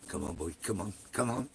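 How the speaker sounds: soft, low come-on to the chat; low coaxing urge. Real, not acted. Waiting on chat for news, a low coaxing murmur. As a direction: coax it out under your breath.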